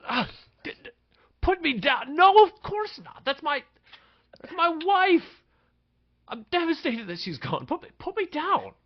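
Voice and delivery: terrified high-pitched begging; panicked squeal. Acted. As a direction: squeal in panic while held up. Chief slammed against the ceiling.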